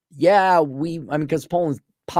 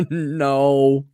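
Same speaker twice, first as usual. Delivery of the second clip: usual speaking voice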